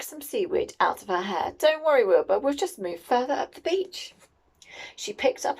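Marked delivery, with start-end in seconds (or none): 1.6-4.1 higher-pitched cheery reassurance; Winnie reassuring Wilbur. A narrator's reading. Winnie to her worried cat on the beach, reassuring.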